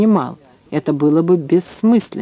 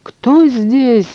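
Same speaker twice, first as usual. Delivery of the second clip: high-pitched alarmed cry; startled yell; 7 semitones higher, 3 dB louder than usual. A narrator's reading. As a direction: cry out as if caught off guard. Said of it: "завопил он". (испуганный вопль)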